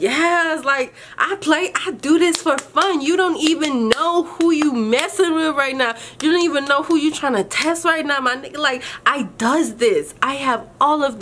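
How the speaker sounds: high, fired-up bragging; cocky hype, voice climbing high; hyped boast. Retold or imitated. Hyped boast retold to tester.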